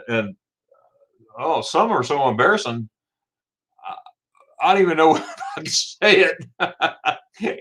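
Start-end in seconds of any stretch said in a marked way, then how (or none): 4.6-7.6 laughing through the words; giggly, higher-pitched embarrassment. Real, not acted. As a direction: barely get it out for laughing. Laughing at own embarrassing memories.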